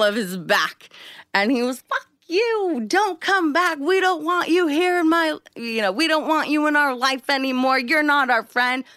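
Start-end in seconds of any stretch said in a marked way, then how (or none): 1.8-8.8 mock angry Dan. Retold Dan's angry shouting.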